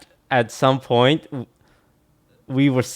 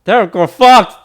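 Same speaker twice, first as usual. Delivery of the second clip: high, loud shout; panicked shout; 11 semitones higher, 12 dB louder than usual. Real, not acted. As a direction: yell it in panic. Panicking as the match starts.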